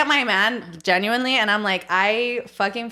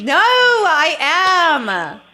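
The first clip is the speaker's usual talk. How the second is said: high, drawn-out wail; loud wail; 7 semitones higher, 8 dB louder, much slower than usual. Real, not acted. Friend calls them British, answered with a loud wail. Mock wail watching childhood video.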